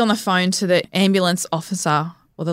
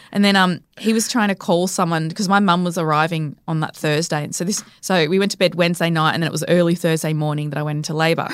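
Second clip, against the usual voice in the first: normal talking voice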